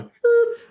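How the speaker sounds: short imitated horn toot; isolated horn blip. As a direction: mimic one horn channel with a little boop. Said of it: "It's like channel one".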